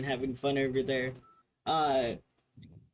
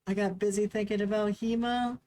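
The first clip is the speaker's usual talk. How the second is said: put-on sheepish kid; 8 semitones higher, 3 dB louder than usual. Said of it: "kind of frown and say".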